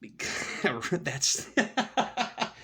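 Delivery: breaking up laughing, words dragged out; real laughter. Real, not acted. Man scoffing at false goods, laughing as he talks. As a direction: laugh helplessly through the words.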